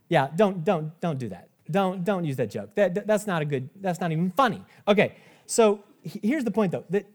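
usual speaking voice

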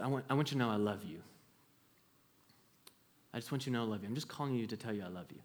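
quiet, choked retelling of telling mom I love you. Retold or imitated. Son on the phone telling his mom he loves her, choked up. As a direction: voice catching on the words. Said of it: "I got choked up in the moment".

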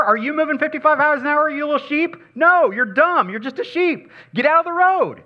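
high, loud pretend scolding; mock yell at a sheep. Driver to a sheep standing in the road, mock yelling. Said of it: "and yell at it".

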